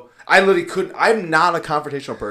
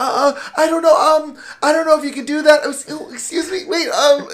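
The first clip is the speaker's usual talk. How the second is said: high, flustered stammering, not angry; imitating panic; 9 dB louder than usual. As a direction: play a receptionist helplessly trying to object. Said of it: "this lady's, like, panicking".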